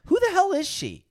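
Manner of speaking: high, loud "who the hell is she"; a sudden incredulous squawk; baffled outburst. Retold or imitated. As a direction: blurt it out, genuinely baffled. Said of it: "'cause I'm like".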